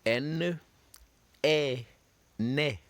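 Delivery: N, A, "na" spelled then said as a syllable; French syllable drill. Shown as a demonstration. Teacher models French syllables for students to repeat. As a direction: sound out N, A and then "na" slowly for the class. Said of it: "Repeat after me".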